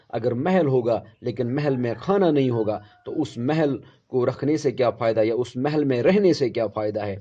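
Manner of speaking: ordinary delivery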